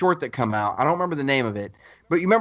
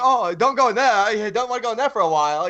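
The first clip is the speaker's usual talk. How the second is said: higher, put-on silly voice of a bumbling actor; mock goofy actor; 6 semitones higher than usual. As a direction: play the clueless fake villain, lightly. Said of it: "He was like".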